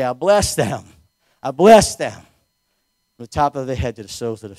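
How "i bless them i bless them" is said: loud, forceful blessing; emphatic declaration. Real, not acted. Preacher leading church in blessing the visitors, forceful declaration. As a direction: proclaim it, hitting BLESS hard.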